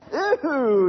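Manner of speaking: high, loud disgusted screech; a hammed-up screech of disgust. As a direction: shriek ew in disgust. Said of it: "he screeched".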